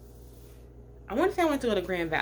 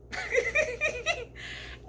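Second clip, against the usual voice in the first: laugh; 4 dB quieter than usual. Real, not acted. Nervous memory of the drive.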